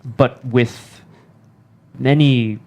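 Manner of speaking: formal speech. Acted.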